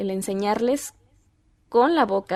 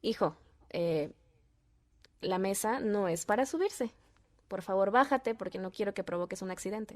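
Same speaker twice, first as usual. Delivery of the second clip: softer, measured parental request; calm, affectionate; 8 dB quieter than usual. Shown as a demonstration. Parent asks child down from the table, calm affection. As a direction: explain kindly and quietly to a child. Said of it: "de una forma cariñosa".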